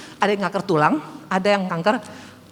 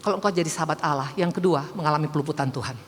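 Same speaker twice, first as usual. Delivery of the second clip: normal talking voice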